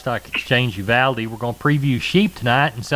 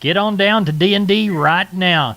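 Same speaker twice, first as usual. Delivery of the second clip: radio ad read, pumped up; holiday sale ad; 5 semitones higher, slower than usual. Acted. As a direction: sell it like a hyped radio spot. Host hypes the sale.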